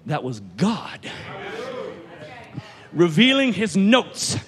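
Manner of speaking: slow, drawn-out sermon climax; slow preaching. Real, not acted. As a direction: dwell on every word.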